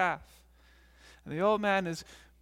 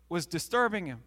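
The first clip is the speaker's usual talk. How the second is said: ordinary delivery, nothing marked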